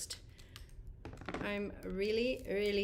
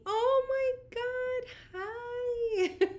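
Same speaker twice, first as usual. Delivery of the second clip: high, drawn-out tearful squeal; overcome with emotion; 12 semitones higher, much slower than usual. Real, not acted. Greeting best friend's kids who just showed up, tearful squeal. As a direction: squeal a greeting, close to happy tears. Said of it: "Oh, I'm gonna cry".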